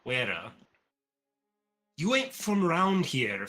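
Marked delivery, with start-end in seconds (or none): 2.0-3.5 higher, louder, slow dwarf drawl; suspicious dwarf sizing up a stranger; gruff dwarf. Acted. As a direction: size up the stranger slowly. Dwarf character questions stranger.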